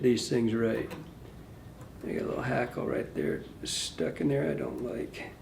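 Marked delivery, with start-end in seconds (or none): 2.1-5.3 low, soft whisper to himself; whispered muttering. Real, not acted. Fussing over a stuck hackle, whispering to himself. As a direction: mutter it for nobody but yourself.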